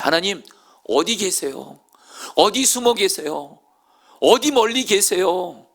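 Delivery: high, loud, slowing cry of longing; anguished lament. Acted. David searching for a hidden God, anguished loud cry. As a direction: drag out the desperate question. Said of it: "다윗이 어떻게 하나님께 기도했죠".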